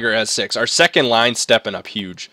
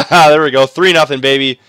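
louder, higher victory call; triumphant celebration; 7 semitones higher, 10 dB louder than usual. Real, not acted. Gamer celebrating the comeback win, triumphant. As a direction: announce the win like a champion.

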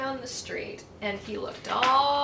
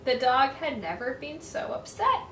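usual speaking voice

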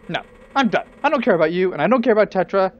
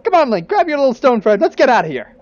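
louder, quicker, pushy call; eager hurrying; 5 semitones higher, 6 dB louder, faster than usual. Acted. Lineback hurrying Link along, loud and quick. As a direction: rush Link out the door.

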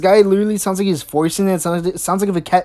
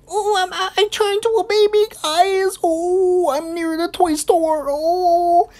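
mock baby voice; 11 semitones higher than usual. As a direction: squeak like a baby proud of himself. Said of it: "and then he's like".

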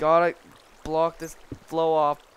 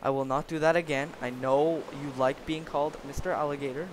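ordinary talk, nothing marked

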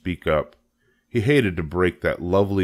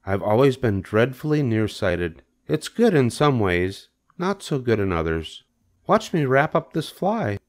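raised, composed spider voice; matter-of-fact Charlotte; 5 semitones higher than usual. Acted. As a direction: explain calmly in a lifted voice.

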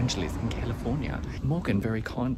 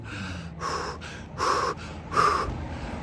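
heavy sigh; 3 semitones lower than usual. Real, not acted. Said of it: "Excuse me if I get a bit out of breath".

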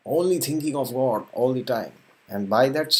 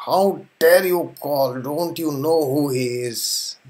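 slow, loud, high angry rebuke; angry Lakshman; 3 semitones higher, 4 dB louder, slower than usual. Acted. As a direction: scold the boatman with indignation. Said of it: "And Lakshman said".

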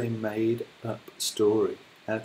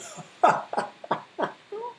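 breathy, much higher wicked cackle; crocodile laughing. Acted. Crocodile mocking the hippo, breathy wicked cackle. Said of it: "The enormous crocodile laughed out loud".

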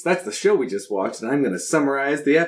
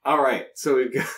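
ordinary talk

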